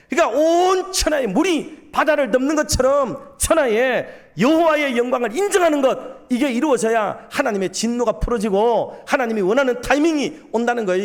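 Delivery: high, emphatic pulpit delivery; forceful preaching. Real, not acted. Preacher explaining God's glory to the congregation, with force.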